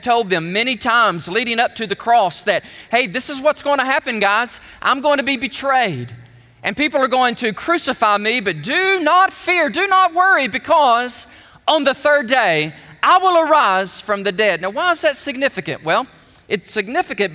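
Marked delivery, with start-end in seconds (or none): 2.9-14.6 raised, forceful preacher's quote building in intensity; emphatic reassurance. Retold or imitated. Preacher quoting Jesus to his disciples before the cross, emphatic reassurance. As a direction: press each promise home, higher pitched.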